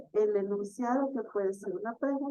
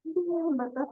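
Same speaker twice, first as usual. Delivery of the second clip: sad tone; 7 semitones higher than usual. Shown as a demonstration. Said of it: "Cuando se está triste".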